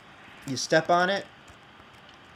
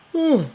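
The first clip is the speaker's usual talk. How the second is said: a big, loud, rising yawn; 12 semitones higher, 8 dB louder than usual. Real, not acted. Gamer yawning loudly while building in Minecraft. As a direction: let a long yawn out mid-sentence. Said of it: "Excuse me for the yawn".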